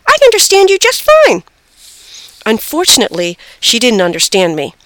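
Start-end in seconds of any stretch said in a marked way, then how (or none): 0.1-1.4 high, loud hamster squeal; frantic squeak. A narrator's reading. Hamster insists the girl is understood, frantic squeak. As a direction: squeak urgently like a tiny animal. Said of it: "I frantically squeaked".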